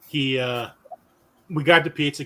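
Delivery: ordinary delivery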